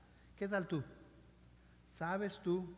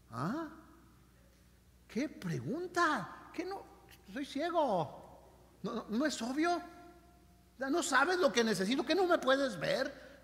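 incredulous; 5 semitones higher, slower than usual. Acted. Blind man reacts to Jesus' question.

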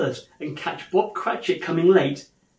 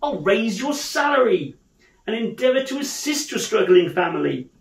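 raised, emphatic, joyful promise; hearty earnestness; 5 semitones higher, 5 dB louder than usual. Acted. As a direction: declare it wholeheartedly to a dear employee. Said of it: "with an earnestness that could not be mistaken".

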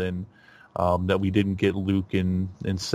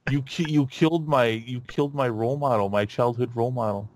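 mock accusation; 4 semitones higher than usual. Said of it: "be like".

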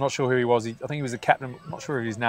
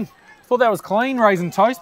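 protest; 9 semitones higher, 4 dB louder than usual. Real, not acted. Commentator repeats it, protesting the call.

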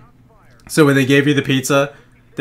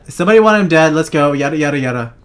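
usual speaking voice

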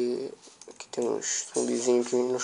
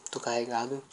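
ordinary delivery

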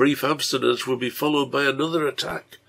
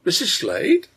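high, louder questioning greeting; pitched well up in surprise; surprised question; 10 semitones higher, 5 dB louder than usual. A narrator's reading. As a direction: react to an unexpected visitor. Unexpected visitor at the door.

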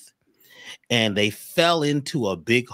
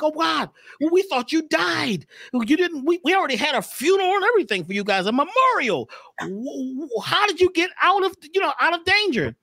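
very high squeaky frog voice, amazed; astonished frogs. Acted. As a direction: frogs stunned to see him alive. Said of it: "the other frogs were like".

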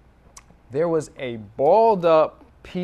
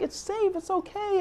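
soothing parent; 4 dB quieter than usual. Retold or imitated. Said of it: "And I'm like".

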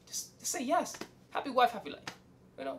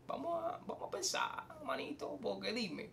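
breathy conspiratorial whisper; hushed whisper; 2 semitones higher, 15 dB quieter than usual. Real, not acted. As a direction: lean in and whisper it. Hushed confiding aside.

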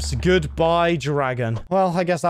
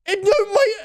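mock crying; 14 semitones higher than usual. Real, not acted. Stammering after losing totem.

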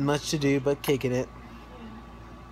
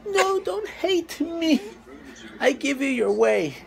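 mock pleading; 11 semitones higher than usual. Pleads with fussy baby.

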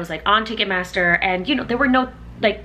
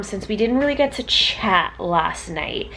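ordinary talk, nothing marked

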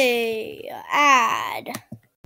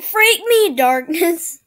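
mock yell; 5 semitones higher, 7 dB louder, slower than usual. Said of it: "He's like".

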